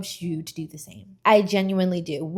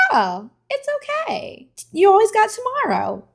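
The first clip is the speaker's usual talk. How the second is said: soothing reassurance; 6 dB louder than usual. Retold or imitated. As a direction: comfort her like a little sister. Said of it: "I'd be like".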